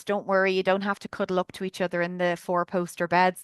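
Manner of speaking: usual speaking voice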